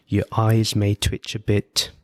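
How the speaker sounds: usual speaking voice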